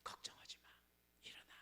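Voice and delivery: whispered reassurance; God whispering comfort to Elijah; soothing whisper. Acted. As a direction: breathe it like soothing a frightened child. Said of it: "하나님이 그를 다독여줍니다".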